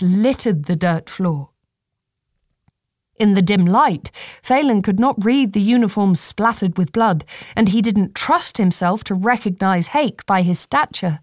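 ordinary delivery, nothing marked

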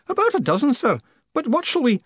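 put-on high, eager old man's voice; pressing to know what to do; old George asking his boss for orders; anxious old postman.

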